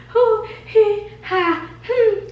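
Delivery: giggly, high-pitched disbelieving laugh; nervous laugh. Retold or imitated. Finding the chair shop closed, high giggling laugh.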